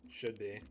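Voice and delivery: "Should" pressed as the key word; stress on should. Real, not acted. Gamer stressing the key word should. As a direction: hedge it, weight on "Should". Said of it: "Being the key, key word here".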